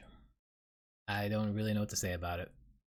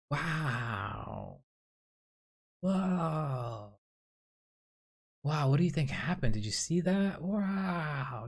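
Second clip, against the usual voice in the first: drawn-out, higher wows, imitating gawkers; mock awe; 6 semitones higher, much slower than usual. Onlookers gawking at the explosion, in mock awe. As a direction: imitate stunned onlookers, voice pitched up. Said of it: "like, in awe".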